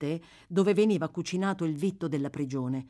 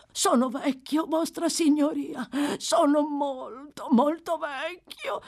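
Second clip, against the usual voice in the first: high, slow whining plea; 10 semitones higher, 3 dB louder, slower than usual. Acted. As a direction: beg the judge for pity. (supplica lenta e acuta)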